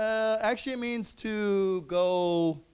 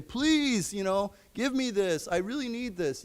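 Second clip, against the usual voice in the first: mock begging; 5 semitones higher than usual. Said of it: "you say".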